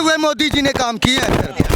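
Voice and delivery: ordinary delivery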